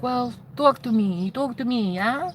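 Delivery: put-on soft, slowed Ukrainian-accented coaxing. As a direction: coax gently in a Ukrainian accent. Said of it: "in this Ukrainian accent".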